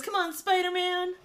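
high, loud imitated cheer; root for the hero like his dad. Dad at the fight, imitated cheering. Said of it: "he starts cheering him on".

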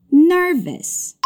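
ordinary talk

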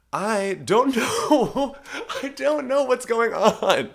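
high, loud laughter through slow words; giggling in disbelief at being so tired; laughing. Real, not acted. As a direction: laugh helplessly while saying it.

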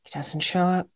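hushed dramatic reveal; whisper. Real, not acted. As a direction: whisper the twist. Teasing reveal.

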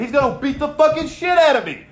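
imitating a coworker's panicked outburst; mock panic. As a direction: blurt it like you just realised you're dead.

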